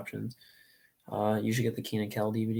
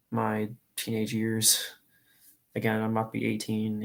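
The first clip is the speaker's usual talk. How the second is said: ordinary talk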